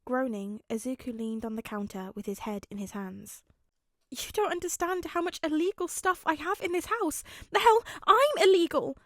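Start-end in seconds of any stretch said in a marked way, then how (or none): 4.1-9.0 high, loud panicked outburst; rising panic that bursts into a yell; frantic boyfriend losing it; almost hysterical. Acted. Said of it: "he was right to sound almost hysterical".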